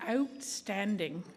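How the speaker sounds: slow drawn-out Canadian accent outstanding. Shown as a demonstration. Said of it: "try my Canadian accent".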